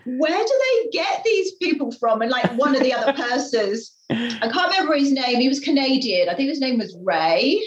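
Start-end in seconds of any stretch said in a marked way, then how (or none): 0.0-2.2 exasperated guest. Retold or imitated. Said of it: "he just looked at me and went like".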